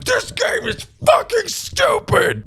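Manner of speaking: furious high scream. Retold or imitated. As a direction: scream it at the game. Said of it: "how loudly a man can scream".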